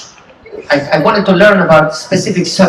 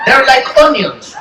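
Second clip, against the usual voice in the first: ordinary delivery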